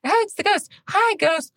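put-on high child voice, delighted; sweet high little-girl hello; kid greeting the ghost. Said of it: "then she's like".

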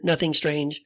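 ordinary talk

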